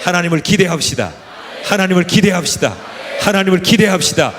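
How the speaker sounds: building rallying call to the congregation; preaching shout. Real, not acted. (점점 커지는 설교 외침)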